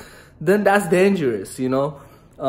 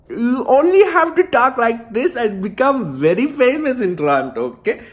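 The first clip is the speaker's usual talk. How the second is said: put-on Indian accent; 5 semitones higher than usual. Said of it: "Number one is the Indian accent".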